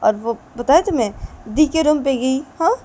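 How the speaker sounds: loud complaining rant. Acted. Wife rants loudly to husband about the crying baby. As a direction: list every grievance in a heated rush.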